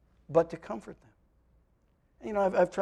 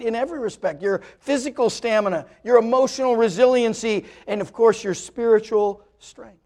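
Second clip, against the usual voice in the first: building preaching; 5 semitones higher, 8 dB louder than usual. Acted. Preacher to his congregation, building to a loud climax. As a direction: build each phrase louder, like a preacher gathering force.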